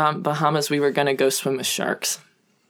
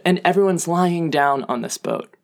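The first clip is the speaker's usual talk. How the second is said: usual speaking voice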